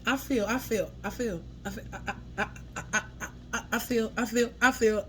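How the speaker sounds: halting stammer, restarting the same words; stuttering as if the words won't come out; imitated stutter. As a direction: get stuck and keep restarting. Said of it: "like somebody can't get their words out".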